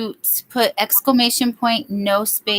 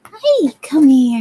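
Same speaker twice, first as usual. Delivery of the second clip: calling the dog; 6 semitones higher, 7 dB louder, slower than usual. Real, not acted.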